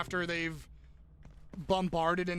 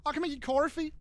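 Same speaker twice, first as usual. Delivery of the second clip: higher-pitched mock Australian question; badly faked Australian, playing up CORFY; bad Australian accent; 7 semitones higher, faster than usual. Reading own texting typo aloud to co-host, bad Australian accent. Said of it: "little bad Australian moment".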